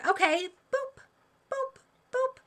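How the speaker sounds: high, light boops; boop sounds. Retold or imitated. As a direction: chirp each boop as the jab goes in. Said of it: "doctors are walking around going".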